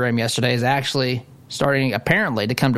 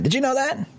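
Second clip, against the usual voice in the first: mock surprise; 12 semitones higher than usual. Real, not acted. Host feigns surprise about debate.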